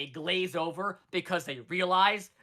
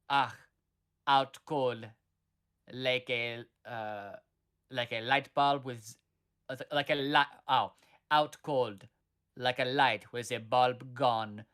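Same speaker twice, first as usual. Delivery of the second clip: put-on slow, drawn-out German-accented line; 4 semitones lower, slower than usual. Friend retelling a cartoon raven's line, in a mock German accent. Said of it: "who is, like, German, I guess, goes".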